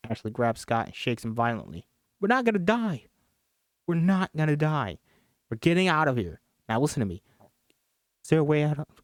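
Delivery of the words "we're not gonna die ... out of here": grip him and insist you'll get out; urgent insistence. Acted. Ashley shaking dying Scott, urgent insistence.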